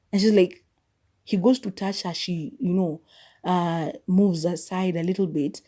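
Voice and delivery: ordinary talk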